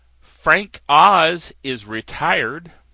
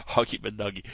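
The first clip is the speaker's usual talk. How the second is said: lightly voiced cartoon dog; a hint of a cartoon dog; 5 semitones lower, 6 dB quieter, faster than usual. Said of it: "It's one of the voices that I do".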